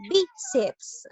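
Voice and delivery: Bíceps with a high, punched BI; stress on BI. Shown as a demonstration. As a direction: pitch BI up high, then drop on ceps. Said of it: "En la sílaba bi".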